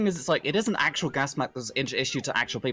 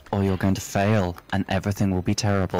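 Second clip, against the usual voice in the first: ominous inner voice; 5 semitones lower than usual. Pretend. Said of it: "That's my inner monologue".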